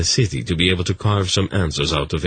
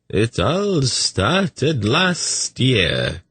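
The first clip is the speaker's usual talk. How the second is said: much higher, very slow, fussy eunuch voice; eunuch Tzadi; 8 semitones higher, much slower than usual. A narrator's reading. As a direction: lift it up high and drawn out, wary.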